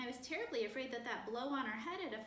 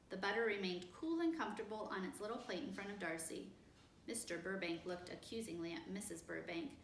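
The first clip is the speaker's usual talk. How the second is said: plain reading voice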